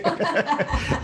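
sudden high laugh; high burst of laughter. Real, not acted. Laughs at the joke.

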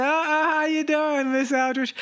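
a man who just whistled at his old teacher stammering a mock-flustered hello; flustered greeting. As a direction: caught out, fumble for words. Catcalled his former teacher.

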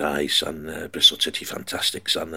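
low, soft Scottish Shankly voice, lightly done; Scottish Shankly impression. Retelling Shankly's answer to a boy, low Scottish voice. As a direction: imitate Shankly's Scottish burr, quietly. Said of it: "he was Scottish".

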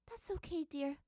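high, soothing mother voice; mother calmly gathering her daughter; gentle mother. A narrator's reading. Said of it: "said Poppy, her mother".

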